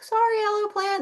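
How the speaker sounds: put-on high, sweet child's apology; high child voice, lightly sorry; kids apologizing. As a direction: mimic little kids saying sorry. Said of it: "the kids would be like".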